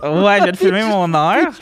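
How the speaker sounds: high, loud hyped-up echo; stoned. Retold or imitated. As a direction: belt it out, buzzing and giddy. Echoes the slang, hyped.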